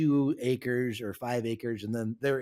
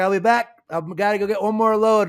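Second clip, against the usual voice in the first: louder, higher retold comeback; defiant grandpa; 7 semitones higher, 9 dB louder than usual. Grandpa answers the yelling neighbor, louder and higher in defiance. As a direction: throw it back at him, full of spite.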